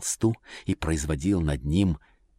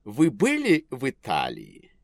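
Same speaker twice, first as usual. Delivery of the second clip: society lady voice; 5 semitones higher, 3 dB louder than usual. A narrator's reading. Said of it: "сказала дама".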